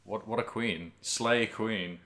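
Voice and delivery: usual speaking voice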